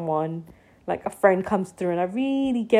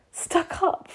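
unvoiced, much higher, stunned whisper of "Stuck up?"; breathless disbelief; 9 semitones higher than usual. Friend says she looks stuck up, stunned whispered disbelief.

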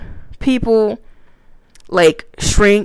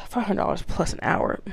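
whispered after a sigh, much softer; awed whisper; 13 dB quieter than usual. Real, not acted. Vlogger stunned by the lawyers' fee, whispering. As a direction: breathe the price out in disbelief.

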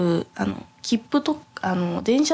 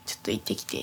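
whispered aside; 11 semitones lower, 10 dB quieter, faster than usual. Retold or imitated. Asking friend to go instead.

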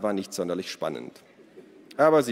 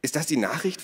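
high, eager questioning; excited question; 7 semitones higher than usual. A narrator's reading. Ada asks about the found paper, excited. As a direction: ask it excitedly, voice lifted. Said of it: "fragte Ada aufgeregt".

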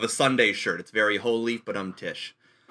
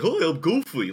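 higher-pitched cartoon character voice; Goofy; 5 semitones higher than usual. Shown as a demonstration. Demonstrating an impression stumbled into one day, Goofy voice. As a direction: put on a hint of a goofy cartoon voice. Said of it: "I can do a Goofy voice".

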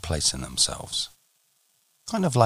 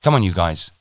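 louder call to rally the troops; rallying yell; 2 semitones higher, 7 dB louder than usual. Acted. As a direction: shout to round up the team. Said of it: "musters all the troops and yells".

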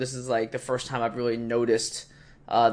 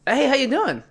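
high, bright front-desk greeting; friendly greeting; 13 semitones higher than usual. Retold or imitated. Front desk guy greets the player, cheerfully high. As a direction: greet him like a cheery doorman.

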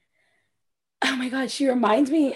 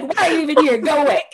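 mock dismissal; 4 semitones higher, 5 dB louder, much faster than usual. Acted dismissal in retold quarrel.

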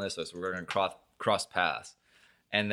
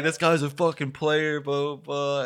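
higher, louder jeering impression; mocking bully voice; 7 semitones higher, 4 dB louder, slower than usual. Imitating the guys mocking him, higher and louder. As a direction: sneer it like a schoolyard bully. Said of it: "they were like".

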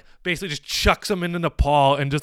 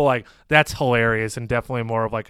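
ordinary talk, nothing marked

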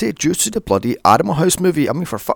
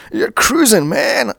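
high eighties California dude quote; bad Californian accent; 10 semitones higher, 4 dB louder than usual. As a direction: put on a hammed-up Californian surfer accent. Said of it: "as bad as that Californian accent".

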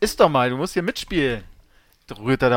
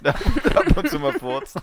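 laughing; 5 dB louder, slower than usual. Real, not acted. Joking about the farting dog.

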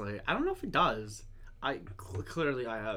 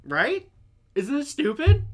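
high, loud exasperated question; indignant; 12 semitones higher, 9 dB louder than usual. Real, not acted. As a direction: pitch it up in disbelief. Indignant rant, voice jumps high.

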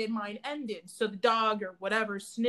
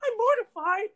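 high, half-laughing mock distress; mortified; 6 semitones higher than usual. Student crying in the office, high mock-mortified. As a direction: imitate her younger self, mortified and near tears.

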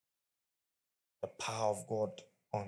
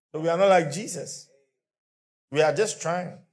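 raised preaching; 8 semitones higher, 11 dB louder than usual. Real, not acted. Preacher driving home point.

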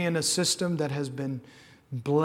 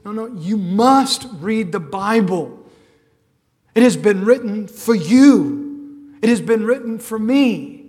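loud, impassioned preaching at full force; fervent preaching; 6 semitones higher, 14 dB louder than usual. Acted. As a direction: cry out to the Lord with full conviction. Urgent preaching reply.

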